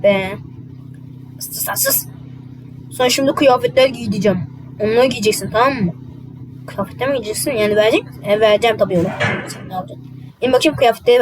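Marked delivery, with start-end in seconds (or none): 1.4-2.1 whispered hush, barely voiced; whispering to hush him. Hushing someone. 9.5-10.1 whisper. Acted. Aside under breath.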